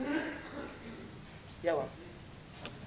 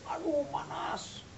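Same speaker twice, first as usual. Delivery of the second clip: soft, slow, drawn-out whine; mock lazy moan, slow and soft; whining about the heat; 4 semitones higher, 7 dB quieter, much slower than usual. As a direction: moan it like a spoiled rider.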